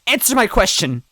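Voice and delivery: loud high demand; demanding shout. A narrator's reading. Toadette demanding an answer, loud shout. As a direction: shout it at her.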